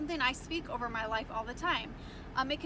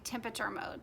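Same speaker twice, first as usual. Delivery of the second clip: normal talking voice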